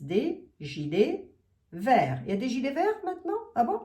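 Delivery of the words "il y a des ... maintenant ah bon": surprised. Real, not acted. Reads absurd viewer question.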